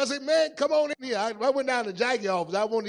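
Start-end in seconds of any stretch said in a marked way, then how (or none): 0.2-1.2 loud, higher call into the room; retold call, raised and loud. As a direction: holler for him to come in. Calling Isaac into the office.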